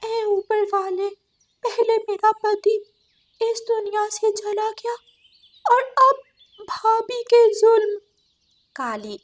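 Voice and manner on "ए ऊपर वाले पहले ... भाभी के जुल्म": high, tearful plea to the heavens; crying crow. Acted. Widowed crow weeps to God about her sister-in-law, high tearful plea. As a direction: cry out to God in grief, pitched high. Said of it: "बैठी रो रही थी".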